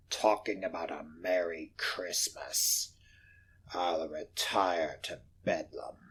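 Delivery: low, hushed, sour grumbling; scornful whisper. Acted. Scrooge sneering at his clerk, hushed whisper. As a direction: grumble it under your breath.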